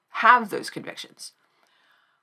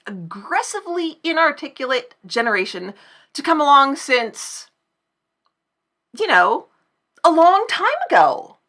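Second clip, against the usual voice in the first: rising, louder and higher to a whiny peak on whatever; mock uptalk rant; 6 semitones higher, 5 dB louder than usual. Shown as a demonstration. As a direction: build the ironic valley rant to a shriek.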